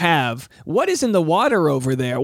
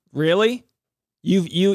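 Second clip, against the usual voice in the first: ordinary talk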